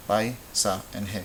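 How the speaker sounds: "Pai, sa, and he" as three separate beats; syllable by syllable. Shown as a demonstration. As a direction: split paisaje into pai, sa, he, keeping ai together. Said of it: "You should hear three".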